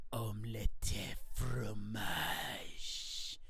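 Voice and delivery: slow breathy whispered French line; hushed mock French whisper. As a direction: whisper it slowly like a secret. Mario whispers line in parody.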